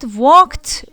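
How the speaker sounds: walked, loud and slow, final T; walked ending in T. Shown as a demonstration. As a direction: pronounce the -ed of walked as T. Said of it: "به صورت تی خوندم".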